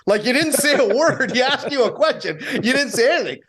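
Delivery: host teasing the intern who froze, laughing reproach; laughing scold. Retold or imitated. Host teasing the frozen intern, laughing as he scolds.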